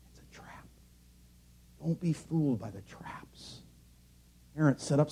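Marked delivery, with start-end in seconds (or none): 0.1-3.6 hushed warning. Real, not acted. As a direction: drop to a grave whisper and draw it out. Preacher's hushed warning about traps.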